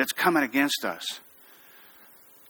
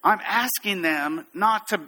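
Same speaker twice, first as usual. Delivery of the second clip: usual speaking voice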